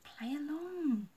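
drawn-out secret prompt; urgent hint. A narrator's reading. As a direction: drop a heavy hint to a friend. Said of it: "shot her a look that said".

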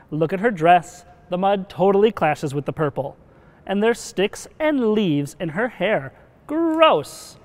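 snooty princess voicing revulsion; disgusted princess. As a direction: pick her apart, building to high disgust. Princesses mock Georgia's mud.

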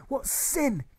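very high, scornful cry; disdainful yell. Acted. Teacher scorning the class, disdainful yell. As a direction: spit it out with disdain. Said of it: "he yells disdainfully".

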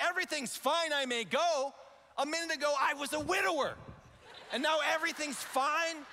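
high, loud incredulous echo; exasperated sarcasm. Retold or imitated. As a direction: throw the words back in disbelief. Sarcastic echo of desk guy.

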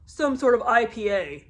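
usual speaking voice

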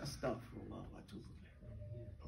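under-the-breath whisper. Retold or imitated. Teacher showing a quiet whispered istighfar under the breath. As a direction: whisper it to yourself. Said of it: "you said".

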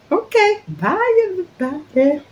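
tiny, very high farewell squeal; playful high-pitched goodbye. Pretend. Vlogger signing off to everybody, playfully high-pitched. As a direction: sing out a silly squeaky bye.

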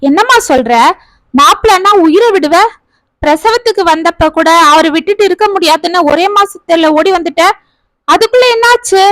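high, pressing questions full of disbelief; incredulous elder brother. Acted. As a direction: brother questioning his sister about leaving her husband, incredulous.

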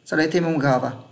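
ordinary talk, nothing marked

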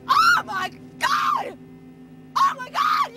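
high, loud, slow outraged cry; outraged shriek. Acted. Bride outraged at being called a liar, shrieking. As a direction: scream it in disbelief.

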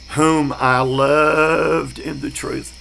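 drawn-out, deliberate verse reading; slow scripture reading. Shown as a demonstration. Preacher to class, reading scripture slowly. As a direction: read the verse slowly and reverently.

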